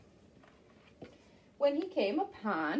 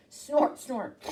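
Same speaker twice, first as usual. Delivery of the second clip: horse snort. Acted. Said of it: "horse noise".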